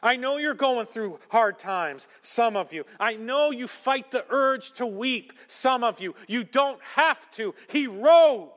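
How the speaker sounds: high, loud preaching to the crowd; fiery preaching. Acted. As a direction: preach it with rising fire. Preaching at full force.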